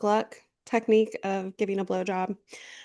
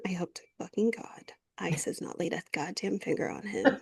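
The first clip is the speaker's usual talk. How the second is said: whispered fury with a laugh; angry whisper; 3 semitones lower, 8 dB quieter than usual. Real, not acted. Host cursing ICE under her breath, whispering angrily. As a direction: hiss the curse under your breath.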